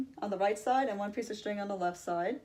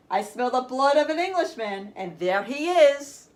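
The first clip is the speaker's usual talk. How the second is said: loud roar, higher, slow on the end; roaring giant; 4 semitones higher, 9 dB louder, slower than usual. Acted. Giant spotting the fleeing Jack, triumphant roar. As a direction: bellow it as you spot your prey. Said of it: "roared the giant".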